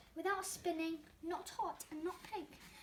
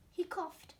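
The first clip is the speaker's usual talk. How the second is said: plain reading voice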